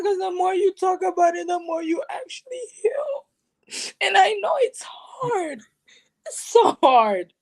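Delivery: real tears breaking through; choked up and crying out. Real, not acted. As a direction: voice breaking as she cries. Breaking down while telling her story.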